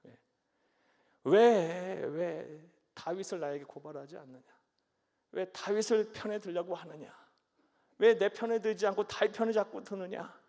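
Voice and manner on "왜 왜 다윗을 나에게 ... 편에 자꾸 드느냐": rising loud accusing questions; angry king. Acted. Saul accusing his servants of siding with David, loud. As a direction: demand it of them like a jealous king.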